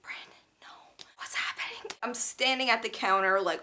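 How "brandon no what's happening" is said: panicked whisper. Retold or imitated. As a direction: hiss it under your breath in alarm. Panicked inner thought, whispering.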